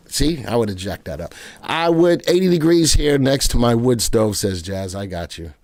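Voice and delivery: normal talking voice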